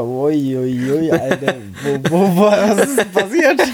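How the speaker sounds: ungläubig lachendes Ui ui ui; lachend erstaunt. Retold or imitated. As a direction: halb lachend, hoch und erstaunt. Erschrockene Reaktion auf Nachricht. (lachend überrascht)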